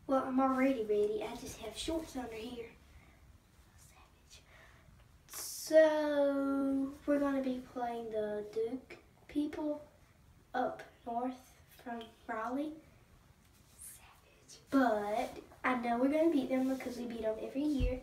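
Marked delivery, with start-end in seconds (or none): 3.8-4.4 breathy whispered aside; whispered catchphrase. Acted. Basketball player whispers his catchphrase to the camera. 13.8-14.6 breathy whispered aside; whispered catchphrase. Acted. Basketball player whispers his catchphrase to the camera.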